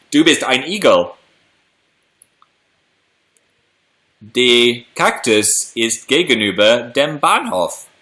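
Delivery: halting attempt at a German accent. Shown as a demonstration. Streamer showing viewers some rusty German, German accent. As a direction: have a go at German in a put-on accent. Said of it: "That accent as well".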